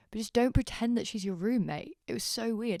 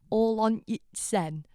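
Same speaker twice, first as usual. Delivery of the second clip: slow, higher mock Sheffield accent; 4 semitones higher, 4 dB louder, slower than usual. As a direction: savour the broad Sheffield phrase. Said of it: "that's the most Sheffield sentence".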